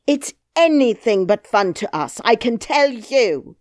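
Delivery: loud, high furious scolding; angry nurse. Acted. Nurse scolds the lost princess, loud and angry. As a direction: rail at the child, voice up.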